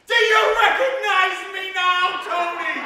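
drawn-out, high drunken yell, hammed up; screaming. Drunk extra outside Tony Shalhoub's trailer, screaming for recognition. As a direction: bellow it slowly at the top of your voice.

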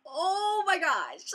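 high, drawn-out amazed cry; freaking out. Retold or imitated. Vlogger reliving her approval news, stunned and thrilled. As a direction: stretch it out, swooping down from high. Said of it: "I was, like, freaking out".

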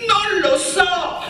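high, slow cry over the crowd; shouting. A narrator's reading. Dina yells to Miretta over the crowd, shouting. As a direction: yell to be heard above the noise. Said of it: "Dina urlava per farsi sentire".

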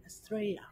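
high answer, "Estrella" with soft y glide; Australian "Straya". Shown as a demonstration. Teacher answering his drill question, high "Straya". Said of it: "hable como los australianos".

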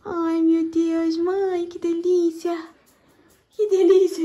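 high, slow, soft delight. Real, not acted. Mother seeing the nursery, soft high delight. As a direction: swoon over the little room.

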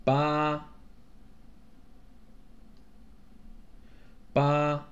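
"八" held level and fairly high; high first tone. Shown as a demonstration. Teacher marking tones on numbers, high level tone. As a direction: sustain "八" without any glide. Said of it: "first tone".

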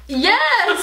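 high, loud burst; triumphant high "Yes!"; exclaimed yes. Real, not acted. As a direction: shout the reveal. Punchline reveal, pitched way up.

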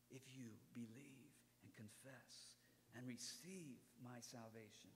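soft whisper; God's whispered invitation. Acted. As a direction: breathe the promise intimately.

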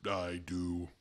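flat deep robot voice; male robot voice. Pretend. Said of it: "a male robot voice".

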